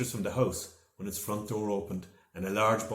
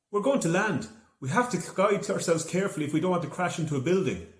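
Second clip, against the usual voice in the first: higher, louder, urgent call; urgent instruction; 9 semitones higher, 5 dB louder than usual. A narrator's reading. Aisling to Joe before landing, urgent and raised. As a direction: warn him to steer. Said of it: "Aisling said".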